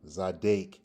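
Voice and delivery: Zadek ending in "dake"; second pronunciation. Shown as a demonstration. Teacher repeats the other form, Zadek with long A sound. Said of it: "two different pronunciations".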